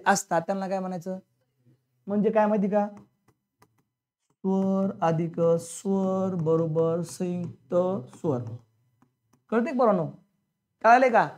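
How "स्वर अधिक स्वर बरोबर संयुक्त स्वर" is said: very slow, drawn-out syllables; slow spelling-out. Real, not acted. Teacher spelling out the vowel formula, slowly drawn out. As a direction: stretch each word out evenly, at half speed.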